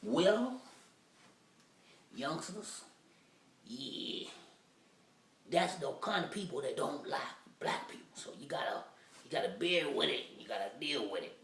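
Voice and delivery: a hint of a wise old man; old man advising young men, lightly voiced. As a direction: counsel the youngsters gently.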